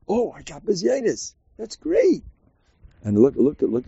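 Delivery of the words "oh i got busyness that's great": high, delighted exclamation; mock-delighted, raised pitch; happy. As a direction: act thrilled at the insult, pitch soaring. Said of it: "you're gonna be so much happier".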